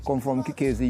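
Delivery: ordinary delivery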